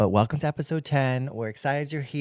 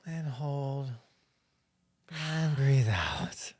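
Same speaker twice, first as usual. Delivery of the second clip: guided breathing. Pretend. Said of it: "take a breath".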